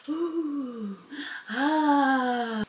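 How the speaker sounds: soft goofy ooh and ah. Retold or imitated. Volunteer clowning while handing raffle prizes, soft goofy coos. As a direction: coo softly, clowning around. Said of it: "so I was just being goofy".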